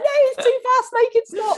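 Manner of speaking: loud, very high mock wail; crying panic. [name] begging her partner on the descent, loud panicked wail. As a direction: wail it out in hammed-up terror. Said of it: "I cried".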